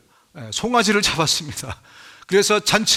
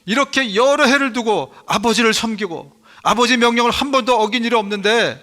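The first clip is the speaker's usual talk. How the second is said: higher, louder resentful protest; elder son's complaint; 5 semitones higher, 4 dB louder than usual. Retold or imitated. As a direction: voice the older brother's grievance, rising. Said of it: "큰아들이 이렇게 얘기합니다".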